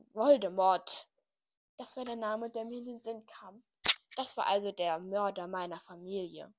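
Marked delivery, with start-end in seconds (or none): none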